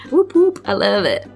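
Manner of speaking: ordinary talk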